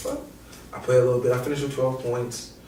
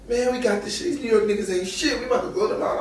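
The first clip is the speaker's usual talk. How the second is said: high cocky trash talk; 10 semitones higher, faster than usual. Retold or imitated. Evan Turner taunting Iona players, high cocky mock. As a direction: taunt the other team. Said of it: "Evan Turner goes".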